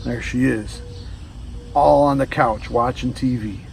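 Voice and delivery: usual speaking voice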